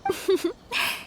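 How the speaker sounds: light flattered chuckle; bashful laugh. Acted. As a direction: laugh off the compliment shyly. Flattered by Paimon's compliment.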